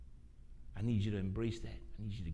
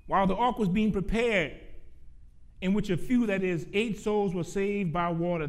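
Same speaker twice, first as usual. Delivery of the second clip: raised, projected scripture reading; preached scripture reading; 8 semitones higher, 6 dB louder than usual. Real, not acted. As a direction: read the verse out to the whole church.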